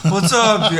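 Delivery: loud, laughing stoner greeting; hammed-up goofy dinosaur on a joint; Barney. Comedian mocking his co-host's voice, loud goofy stoner. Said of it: "I'm Barney smoking a joint".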